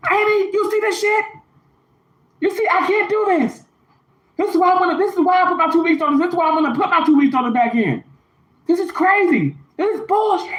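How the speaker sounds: imitating a furious coworker. Coworker cursing at Amy in the operating room, high mock rant. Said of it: "this is verbatim now. I'm gonna try and do as best I can".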